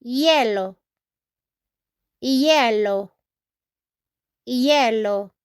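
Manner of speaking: "hielo" as YEH-lo, silent h; Spanish pronunciation. Shown as a demonstration. Teacher modelling Spanish for ice, "hielo" as YEH-lo. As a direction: say "hielo" opening on a y glide. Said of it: "Here we say".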